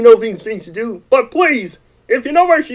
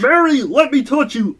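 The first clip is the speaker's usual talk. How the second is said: normal talking voice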